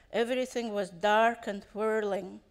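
ordinary delivery, nothing marked

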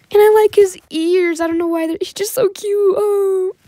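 high-pitched gushing over something cute; squealy cuteness overload. Real, not acted. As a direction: let the pitch shoot up with adoration.

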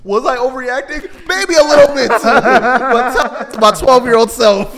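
high, loud and cracking up; loud, amused self-defence; laughing loudly. Real, not acted. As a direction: argue it while bursting with laughter. Laughing at own story.

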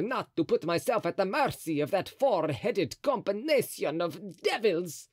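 the cardinal gloating over his bag of coins; voicing Mazarin. A narrator's reading. Cardinal grumbling to himself.

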